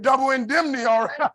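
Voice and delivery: high, loud punchline delivery; emphatic comic comeback. Retold or imitated. Husband reassuring wife about the payout, loud comic comeback. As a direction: belt out the answer as a joke.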